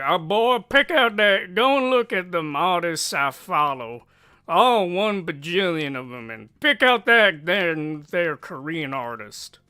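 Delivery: loud, high hammed-up voice; put-on overexcited shouting; mock exclamation. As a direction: exaggerate it at full volume, easing off at the end. Mock quote of someone choosing artists.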